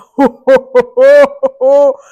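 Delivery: huge loud belly laugh; giant's roaring laugh; booming giant laugh. Acted. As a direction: let out a thundering giant's laugh.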